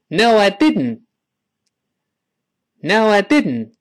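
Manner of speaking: No, I didn't said quickly, didn't ending in a bare n with no t; quick No, I didn't with the t unheard. Shown as a demonstration. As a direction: speed it up and swallow the final t of didn't. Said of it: "再快一点，最后那个t也听不到了".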